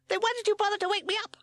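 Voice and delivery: very high, fast squeaky bat. A narrator's reading. Woken Bat complaining to the crew, fast squeak. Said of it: "said Bat".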